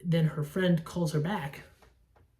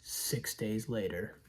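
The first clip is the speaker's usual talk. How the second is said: low, slow and much softer, a dark trailer voice; grim movie-trailer line; 8 semitones lower, 10 dB quieter, slower than usual. Retold or imitated. Reviewer imitating the hero's grim opening line, low and slow. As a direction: drop low and slow like an ominous title card. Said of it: "his face being like".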